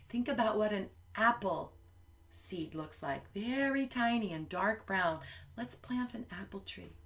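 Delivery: ordinary delivery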